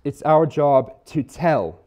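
"tell" held back, then drawn out with weight; slow line landing firmly on the last word, "tell"; stress on TELL. Shown as a demonstration. Preacher giving the congregation the next emphasis, stressing "tell". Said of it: "the next emphasis".

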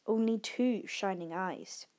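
usual speaking voice

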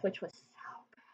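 breathy whispered aside. Real, not acted. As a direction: drop to a whisper for a secret aside. Aside about the vegan meatloaf.

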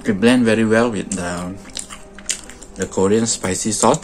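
chewing while talking; muffled chewy speech; mouth full. Real, not acted. Seen 2: Keeps chewing the sandwich bite between phrases; lips pursed, cheeks full.

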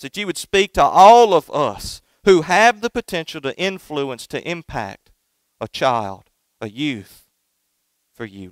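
earnest pulpit prayer, rising and falling; opening prayer. Acted. Pastor prays before the sermon, earnest prayer voice. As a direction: pray it over the congregation.

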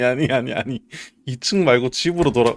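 ordinary talk, nothing marked

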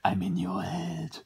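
slow, high eerie whisper; haunting voice. Pretend. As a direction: whisper like a ghost in her head. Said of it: "I'm an eerie voice".

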